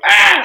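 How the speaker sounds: high, loud mock shriek; weird predator sound. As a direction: squeak it like a frightened kid. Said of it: "he makes, like, a weird".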